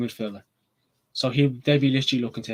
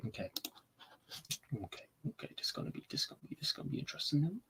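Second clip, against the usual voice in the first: hushed whisper to himself, a bit fast; 3 semitones higher, 17 dB quieter, much faster than usual. Real, not acted. Fan whispering before revealing how United win, hushed. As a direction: whisper it, quick and secret.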